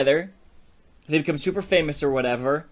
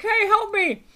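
very high-pitched tiny cry for help; little voice. Retold or imitated. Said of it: "he hears a little voice".

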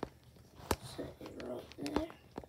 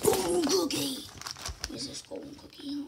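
put-on British accent, loud on the exclamation; 5 semitones higher, 6 dB louder than usual. Shown as a demonstration. Playing a game and announcing the accent, put-on British accent. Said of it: "I'm using my British accent".

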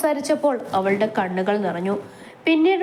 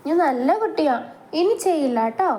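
put-on childlike promise; 7 semitones higher than usual. Man-child promising to behave.

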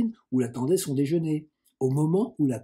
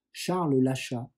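ordinary delivery, nothing marked

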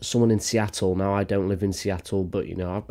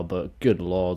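ordinary talk, nothing marked

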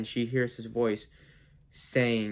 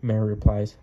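usual speaking voice

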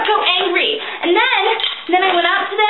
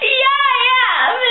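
high, drawn-out insistence; whiny protest; 6 semitones higher, slower than usual. Retold or imitated. Singer insisting to hecklers she is famous, whiny protest. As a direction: stretch it into a childish whine.

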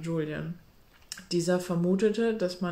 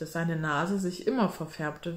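usual speaking voice

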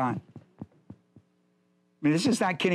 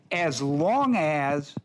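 drawn-out phrase; 3 semitones lower, much slower than usual. Shown as a demonstration. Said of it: "stretching key phrase".

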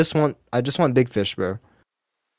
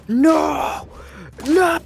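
dismayed shout. Real, not acted. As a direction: shout no at the paywall. Learns hats cost money.

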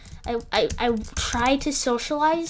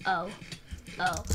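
ordinary talk

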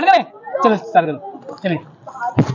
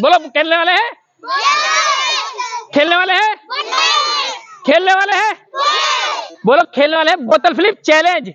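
hype shout; 9 semitones higher than usual. Real, not acted. Host hyping up kids for challenge.

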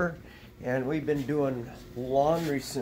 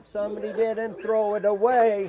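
high, fussy voice for the horses; horses' put-on grumbling, pitched high; mock horse voice; 7 semitones higher than usual. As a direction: play the horses as whiny complainers. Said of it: "gets their little".

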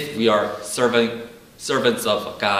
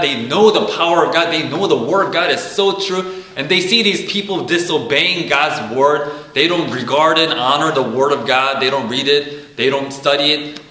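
raised, louder preaching voice, building conviction; preaching at full force; 4 semitones higher, 5 dB louder than usual. Acted. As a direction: preach it out to the congregation. Preaching about angels and God.

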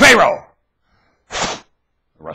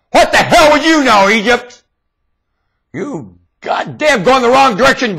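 ordinary talk, nothing marked

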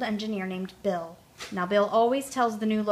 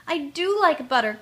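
higher and louder, joyful; delighted cry; 6 semitones higher, 5 dB louder than usual. A narrator's reading. Tootle among the buttercups, a joyful cry. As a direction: cry out the discovery happily. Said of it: "cried Tootle".

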